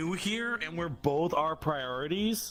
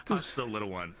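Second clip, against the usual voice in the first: mock soothing; 5 semitones lower than usual. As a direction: soothe like a parent.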